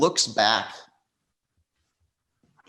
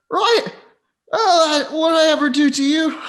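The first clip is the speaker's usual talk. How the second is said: very high, loud, aggrieved wail; wounded man's outcry; 7 dB louder than usual. Acted. Wounded man protesting to Parallax, high-pitched and aggrieved. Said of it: "He looks up at Parallax and says".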